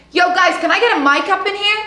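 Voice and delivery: loud casual call for a mic. Retold or imitated. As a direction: shout it out casually to the back. Calling for a mic on stage.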